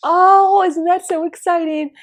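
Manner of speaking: very high, loud squeal; excited friend. Retold or imitated. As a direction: squeal with delight. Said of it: "my friend excitedly calls up".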